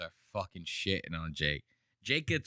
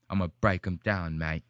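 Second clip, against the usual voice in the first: low mock British accent; Anthony Joshua; 5 semitones lower, 3 dB louder than usual. Streamer quoting the boxer's pre-fight promise, low British accent. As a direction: imitate a British boxer's firm promise, pitched down. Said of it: "interviews with Anthony Joshua before this".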